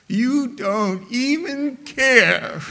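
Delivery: high, loud, drawn-out mock accusation; slow, exaggerated wail of reproach; upset wife. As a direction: imitate a wounded wife protesting. Upset wife accuses her husband.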